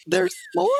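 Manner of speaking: high, stunned little question; overwhelmed disbelief. Retold or imitated. Teacher offered a private tour, high stunned disbelief.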